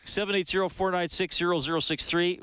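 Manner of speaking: bright announcer read of the phone number; reading digits one by one. Real, not acted. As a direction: read each digit clearly, on-air. Host reads phone number on air.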